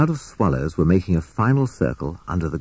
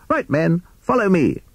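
shouting; 10 semitones higher than usual. A narrator's reading. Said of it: "he shouted".

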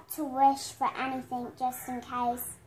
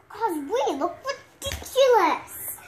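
chorus voice; 6 semitones higher, 4 dB louder than usual. Acted. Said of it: "says everyone together".

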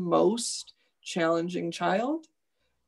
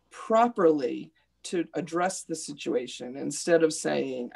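ordinary talk, nothing marked

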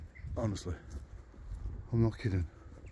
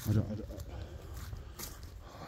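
scared whisper; 8 dB quieter, much slower than usual. Real, not acted. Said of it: "I'm freaking out a bit now".

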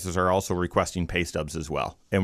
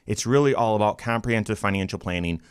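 ordinary delivery

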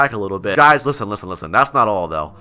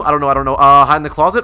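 ordinary delivery

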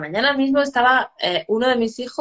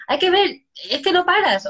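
much higher, fed-up parent telling off; exasperated scolding; 8 semitones higher than usual. Retold or imitated. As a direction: scold the clumsy kid with a sigh. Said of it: "la mayor reacción es decir". (regañina exasperada, mucho más aguda)

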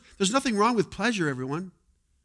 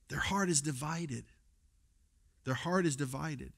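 soft repeat; 4 semitones lower, 8 dB quieter, slower than usual. Shown as a demonstration.

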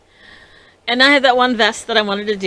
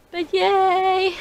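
much higher little cheer; excited cheer; 11 semitones higher than usual. Real, not acted. Knitter celebrating her finished raglan sweater, high cheer. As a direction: squeal a tiny yay.

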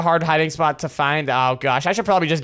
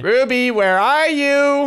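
drawn-out, higher searching call; 5 semitones higher, much slower than usual. Pretend. Seeker calls for a hidden Ruby, drawn-out calling. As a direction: stretch it out to reach someone hidden.

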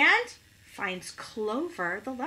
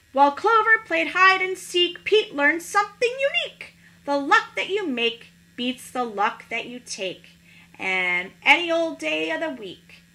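rhyme; 5 semitones higher, 6 dB louder than usual. Acted. Clover recites final poem.

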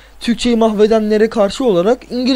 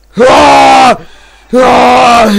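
sharp loud shouts as he shoots; shouting; 3 semitones higher, 10 dB louder than usual. Real, not acted. Gamer shouting as he strikes the ball. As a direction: bark out a loud ha twice. Shot taken in game.

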